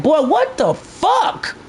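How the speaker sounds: high, drawn-out disbelief; retold exasperation. Streamer recalling being fed up with the game, exasperated. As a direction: stretch it out high, exasperated, rising at the end.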